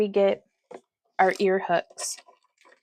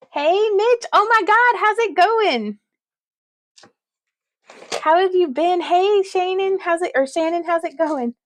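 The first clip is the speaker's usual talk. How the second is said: excited greeting; 10 semitones higher, 6 dB louder than usual. Real, not acted. Greeting chat viewers excitedly.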